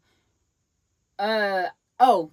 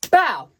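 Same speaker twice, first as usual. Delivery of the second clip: loud, high "bow" for a punch; imitated punch sound; 6 semitones higher, 10 dB louder than usual.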